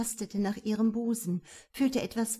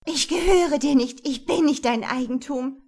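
defiant shout; 5 semitones higher, 8 dB louder than usual. Acted. Woman defies her attacker.

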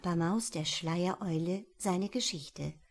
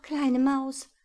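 higher, louder owl calling; calling after; 6 semitones higher, 6 dB louder than usual. Acted. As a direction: call him back. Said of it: "rief ihm die Eule nach".

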